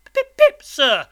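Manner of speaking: very high, loud squeaked answer; panicked, childlike yelp; terrified boy. A narrator's reading. Boy gives his name to the convict, terrified.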